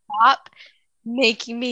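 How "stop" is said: sharp loud snap. Real, not acted. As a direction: bark it out sharply. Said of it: "Stop yelling at me".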